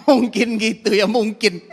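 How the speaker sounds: laughing through the words. Real, not acted. Telling the congregation, laughing at the memory. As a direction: chuckle it out slowly, amused.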